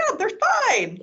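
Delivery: put-on high, light kid voice; imitating her son, high and breezy; child voice. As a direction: lightly mimic a young son brushing it off. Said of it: "he's like".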